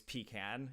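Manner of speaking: "pecan" as PEE-can; Southern pecan. Shown as a demonstration. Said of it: "in the South it was always".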